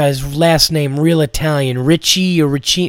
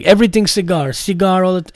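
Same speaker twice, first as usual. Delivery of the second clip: Armenian accent impression; 4 semitones higher, faster than usual. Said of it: "imitating this guy".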